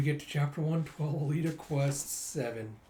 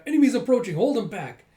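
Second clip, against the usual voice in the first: much higher, loud battle warning; alarm shout; 8 semitones higher, 9 dB louder than usual. Acted. Soldier warning defenders of the enemy, shouting. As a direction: call out the alarm.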